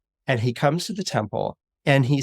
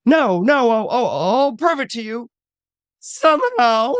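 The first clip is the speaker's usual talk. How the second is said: high, loud stammering vow; frantic boy, pitched way up; flustered defiance; 9 semitones higher, 5 dB louder than usual. Acted. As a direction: blurt it out desperately. Boy vows proof to his mockers.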